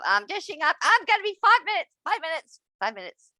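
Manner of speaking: high mock call, fading on the repeats; calling to dinner. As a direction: call out, then trail off tired.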